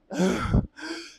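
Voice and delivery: big rising gasp in; deep gasp. Shown as a demonstration. Showing how she refills air, gasping. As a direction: suck in a deep breath. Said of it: "I have to get rid of the air and then".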